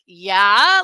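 loud, rising "Yeah?" with sass; attitude. Retold or imitated. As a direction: answer with a loud rising "Yeah?" ready to argue. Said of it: "about to give her attitude".

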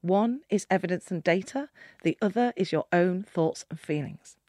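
soft, slow, a touch reassuring; lightly voiced calm mentor; calm advice. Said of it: "you just turned to me and said".

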